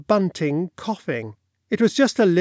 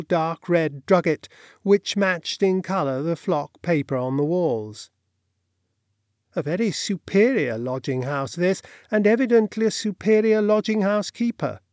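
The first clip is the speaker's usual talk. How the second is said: ordinary delivery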